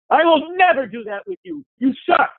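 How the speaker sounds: mock yell. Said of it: "You yell at somebody".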